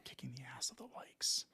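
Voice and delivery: breathy repeat under the breath; whisper. Real, not acted. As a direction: whisper it back, amused. Whispers repeat of cohost's words.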